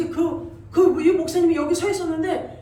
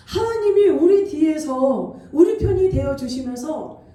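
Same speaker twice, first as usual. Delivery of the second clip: full-force preaching; 8 dB louder than usual. Acted. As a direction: preach it to the congregation with conviction. Preacher proclaims victory.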